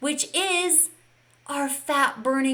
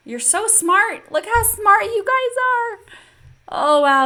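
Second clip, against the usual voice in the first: higher, louder and slow, beaming at the class; gushing praise; 10 semitones higher, 7 dB louder, slower than usual. Real, not acted. Coach thrilled by her students' right answers, gushing loud praise. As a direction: pour out delighted praise.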